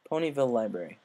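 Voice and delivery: usual speaking voice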